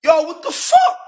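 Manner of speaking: high, stunned yo what the fuck; reliving the shock, pitched high; high disbelieving cry. Retold or imitated.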